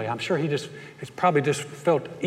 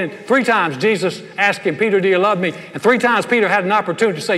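loud, raised preaching voice; preaching at full force; 5 semitones higher, 5 dB louder than usual. Acted. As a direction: drive the sermon home, full volume.

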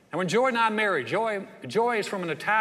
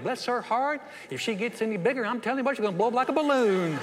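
high, sugary backbiting; Southern gossip; 5 semitones higher than usual. Acted. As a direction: gossip sweetly once they've left, hammed up. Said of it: "wait till you're gone to talk about you".

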